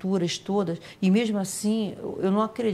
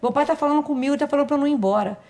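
put-on high, louder little boy begging; child pleading; 9 semitones higher, 3 dB louder, faster than usual. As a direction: protest like a scared small child. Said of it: "son pleading to stay".

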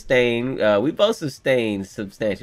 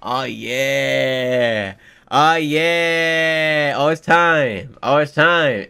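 drawn-out cheer growing louder; building hype; 4 dB louder, much slower than usual. Real, not acted. Hyped reaction to coming fight.

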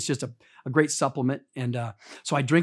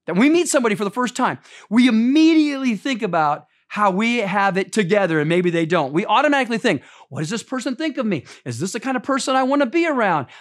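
higher, louder preacher's delivery, driving each line; preaching at full force; 7 semitones higher, 5 dB louder than usual. Acted. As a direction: preach it out to the congregation. Preaching the scripture passage.

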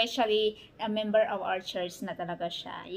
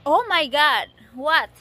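surprised; 5 semitones higher, 10 dB louder than usual. Real, not acted. Surprised reaction.

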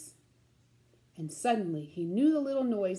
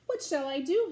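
ordinary delivery, nothing marked

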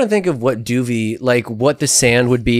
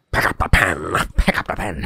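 hammed-up villain command; Grinch; 7 semitones lower, 4 dB quieter than usual. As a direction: lower cartoon villain voice. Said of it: "the voice was just the Grinch".

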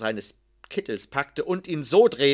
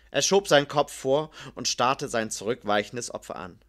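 ordinary delivery

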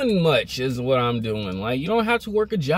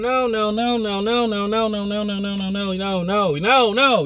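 emphatic refusal; 4 semitones higher than usual. Real, not acted. Rapid string of refusals.

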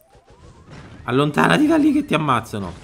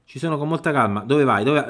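usual speaking voice

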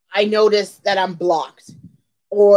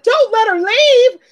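much higher, slow, sweet pleading voice; imitating Tony; 13 semitones higher, 5 dB louder, slower than usual. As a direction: plead in a high, sweet, drawn-out voice. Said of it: "Tony is so sweet".

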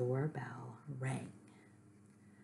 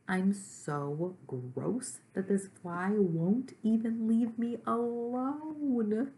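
high, slow, drawn-out wail; 8 semitones higher, 5 dB louder, much slower than usual. A narrator's reading. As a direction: draw out the misery, climbing higher to the end. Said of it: "he wailed".

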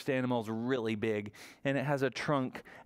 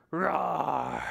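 mock roar for the kids; playful lion growl; hammed-up friendly roar; pretend lion roar; 2 semitones higher, 3 dB louder than usual. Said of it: "give a roar".

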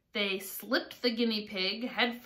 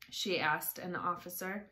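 ordinary delivery

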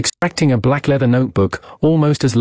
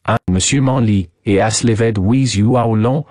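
put-on French-accented questioning. Detective asks madame about her guest, French-accented. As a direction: ask it as a French sleuth.

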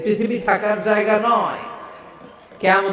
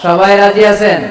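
much louder rallying call; rousing call to crowd; 3 semitones higher, 9 dB louder than usual. Real, not acted. Preacher urging the crowd to raise their hands, loud rallying. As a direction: rouse the whole crowd to answer. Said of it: "হাত তুলে আল্লাহর দেখান".

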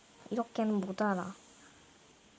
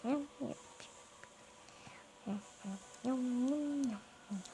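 whisper; 11 dB quieter than usual. Real, not acted. Whispered hums.

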